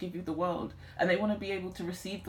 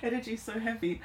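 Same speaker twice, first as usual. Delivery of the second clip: raised, tearful voice with a small laugh; trying not to cry; 4 semitones higher than usual. Real, not acted. Reader overwhelmed by heavy energy, holding back tears. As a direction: fight back real tears, laughing a little. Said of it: "I'm trying not to cry".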